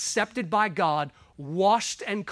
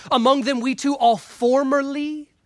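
formerly leaned on, higher and louder; stress on formerly; 5 semitones higher, 6 dB louder than usual. Shown as a demonstration. Preacher reading scripture to his church, formerly stressed. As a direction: hit the word formerly hard. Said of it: "emphasis on the word formerly".

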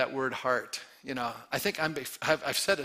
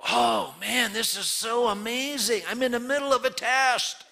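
much higher, louder, slowed delighted voice; eager excitement; 10 semitones higher, 5 dB louder than usual. Retold or imitated. Pastor acting out a joyful believer in trials, high and delighted. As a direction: sound thrilled to be tested. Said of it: "have an attitude going".

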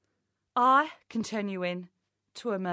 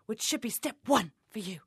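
ordinary talk, nothing marked

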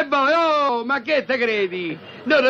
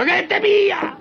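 much higher, incredulous cry on pia; exasperated repeat; 8 semitones higher, slower than usual. Acted. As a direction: throw the repeat up high in disbelief. Father repeats, more exasperated. (ripetuto molto più acuto, incredulo)

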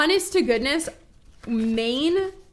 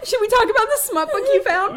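high, quick, after a gasp; excited tease; 10 semitones higher, 4 dB louder, faster than usual. Real, not acted. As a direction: tease her, fast and high. Gasps before teasing question.

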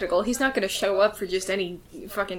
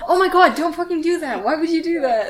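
raised, half-laughing outcry of disgust; grossed-out protest; 6 semitones higher than usual. Real, not acted. Player recoiling from a friend trying to lick their ear, grossed out.

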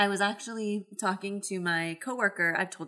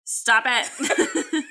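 higher, louder, giggly protest; laughing protest; 9 semitones higher, 4 dB louder than usual. Real, not acted. Storyteller interrupted by partner's teasing, laughing protest. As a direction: protest while breaking into real laughter.

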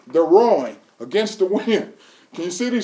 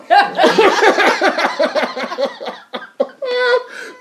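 a long rolling laugh, much higher; 10 semitones higher than usual. Real, not acted. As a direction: crack up at your own joke. Preacher cracks up at own joke.

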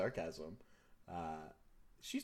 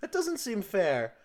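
daughter objecting to parent's rule; whiny protest; 10 semitones higher, 8 dB louder than usual. Acted.

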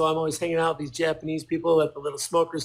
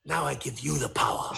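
put-on solemn, lower Japanese accent; 3 semitones lower, 4 dB quieter than usual. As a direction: bestow it like a mock Japanese master. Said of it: "here's my Japanese accent".

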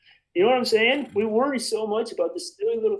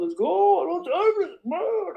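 frustrated self-talk; 10 semitones higher than usual. Retold or imitated. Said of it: "I get really upset".

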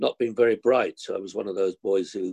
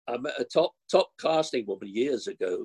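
ordinary talk, nothing marked

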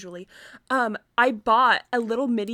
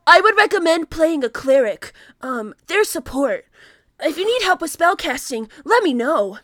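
loud overacted anime hero; anime protagonist voice; 4 semitones higher, 8 dB louder than usual. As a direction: play the eager anime protagonist. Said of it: "slice of life protagonist movement".

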